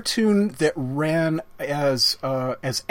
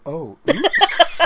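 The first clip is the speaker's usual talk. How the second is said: laughing; 5 dB louder than usual. Real, not acted. Laughing at the joke.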